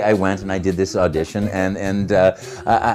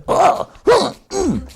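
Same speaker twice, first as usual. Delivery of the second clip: high, squeaky effort sounds; little cartoon huffs; high cartoon plumber noises; Mario grunts; 4 dB louder, much slower than usual. Shown as a demonstration. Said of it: "or, you know".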